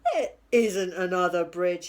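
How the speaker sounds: a grumbling troll talking to himself. A narrator's reading. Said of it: "he said".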